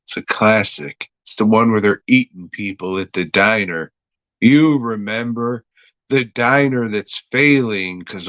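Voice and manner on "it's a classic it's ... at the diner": ordinary talk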